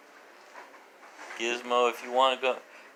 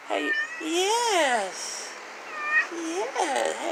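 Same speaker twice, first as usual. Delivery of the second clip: much higher, slow sing-song; high pet talk; slower than usual. Real, not acted. Owner asking Sugar how she's doing, high slow coo. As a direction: stretch it out sweetly up high.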